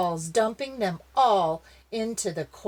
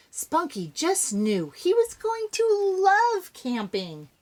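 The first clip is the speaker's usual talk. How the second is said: excited; 12 semitones higher, 5 dB louder than usual. A narrator's reading. Narrator voicing Spunky's excitement.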